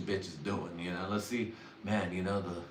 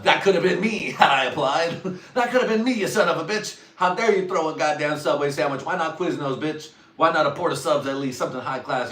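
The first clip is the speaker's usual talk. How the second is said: much higher and louder mock rant; mock outrage; 7 semitones higher, 8 dB louder than usual. Yelling at the sandwich thrower, hammed-up outrage. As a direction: rage at the thrower for comic effect.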